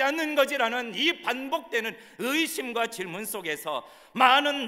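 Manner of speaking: high, loud, impassioned sermon climax; full-force preaching. Acted. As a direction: drive it out to the whole congregation at full voice. (높고 우렁차게 외치는 열정적인 설교)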